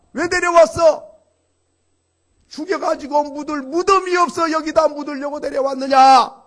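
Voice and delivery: loud, high acted outcry; an angry crowd crying out in protest; resentful wailing complaint. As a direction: shout the blame at the leader. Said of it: "원망과 불평을 하면서 통곡을 하고".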